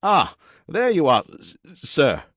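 high, loud robot with a stutter; malfunctioning robot butler voice; glitchy robot servant. Acted. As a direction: announce yourself high and loud, catching on the S.

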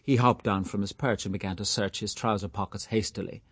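ordinary delivery, nothing marked

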